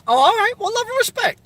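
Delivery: a high, surprised yelp of acknowledgment; surprised high squeak. Real, not acted. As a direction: pitch jumps way up, startled and amused. Viewer says he's a male.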